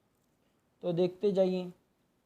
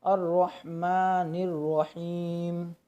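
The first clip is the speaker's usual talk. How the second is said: slow chant with a doubled रॉ; slow recitation; much slower than usual. Shown as a demonstration. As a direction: stress the double रॉ, drawn out. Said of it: "रॉ मुशद्दद है तो इसको दो मरतबा". (धीमी तिलावत, रॉ पर तशदीद)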